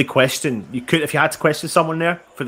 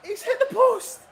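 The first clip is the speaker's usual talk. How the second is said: laughing in disbelief. Real, not acted. After the miss.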